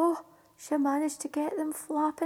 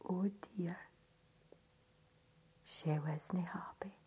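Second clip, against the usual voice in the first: ominous whisper, dropping right down; a whispered storyteller's oh dear; hushed dread; 9 semitones lower, 12 dB quieter than usual. A narrator's reading. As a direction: hush them for the bad news. Storyteller hushes as Betty sours.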